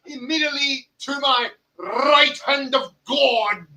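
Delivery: high, booming ring-announcer call; announcer intro. Acted. Host introducing his co-host to the audience, booming announcer call. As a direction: shout it like introducing a champion.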